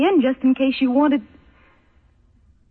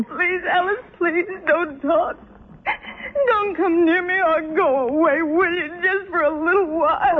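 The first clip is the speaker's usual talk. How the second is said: tearful pleading; 6 semitones higher than usual. Acted. Anne sobbing at Alice to leave her alone. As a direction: beg through tears.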